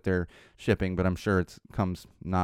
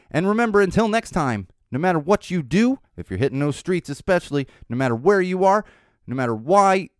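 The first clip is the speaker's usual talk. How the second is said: high, loud, rallying announcer delivery; podcast sign-off; 8 semitones higher, 5 dB louder than usual. Acted. Host rallying listeners as he signs off, announcer style. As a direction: build a punchy catchphrase, lifting each phrase.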